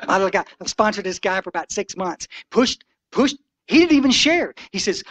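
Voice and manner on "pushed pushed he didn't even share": higher, louder, incredulous retelling; excited retelling. Retold or imitated. Telling the crowd about being passed over, incredulous. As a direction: tell it like you still can't believe it. Newcomer shoving past him.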